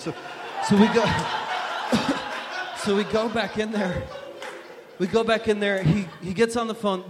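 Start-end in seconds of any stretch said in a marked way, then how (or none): 1.1-2.8 short unvoiced laugh of disbelief; breathy laugh. Real, not acted. Laughing retelling the police visit. 2.8-7.0 laughing while telling. Real, not acted. Laughing retelling the police visit.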